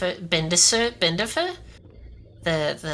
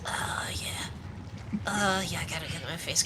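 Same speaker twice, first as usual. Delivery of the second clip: blissful whisper; 8 dB quieter, slower than usual. Pretend. Mock shower bliss.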